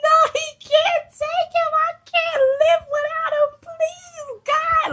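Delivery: high, loud, wailing begging to God; retold bawling plea. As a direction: beg God through sobs, voice cracking high. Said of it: "I started bawling".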